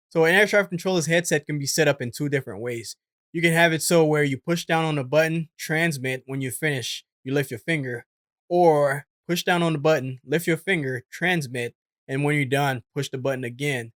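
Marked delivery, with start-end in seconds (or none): none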